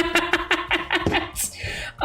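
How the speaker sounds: high breathless giggle; laughing. Real, not acted. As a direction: trail off into wheezy laughter. Laughing at mic suggestion.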